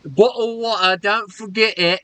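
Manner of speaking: high-pitched mock British voice, dropping the t sounds; mock British accent. Friend ribbing a teammate about water, mock British accent. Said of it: "The bloody bottle of water".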